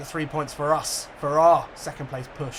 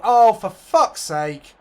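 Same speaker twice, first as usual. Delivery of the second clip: loud, slow, higher drawn-out curse; furious curse; 6 semitones higher, 11 dB louder, much slower than usual. Real, not acted. Gamer swearing at a poor header, drawn-out furious curse. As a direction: spit it out in disgust.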